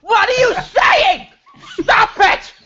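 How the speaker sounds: high, loud laughing protest; laughing shout. Real, not acted. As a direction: shout through real laughter. Breaking up laughing mid-scene.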